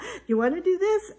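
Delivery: a hint of a sing-song self-pep; talking to myself. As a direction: coax yourself playfully. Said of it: "I try to talk to myself".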